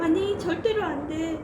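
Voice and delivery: loud, firm no; child refusing to follow a stranger; firm refusal. Acted. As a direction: refuse firmly and raise the voice.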